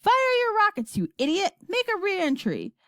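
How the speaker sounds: high, loud, angry order; shouting. A narrator's reading. President ordering astronauts home, shouting. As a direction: bellow commands at an idiot. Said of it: "shouted the president".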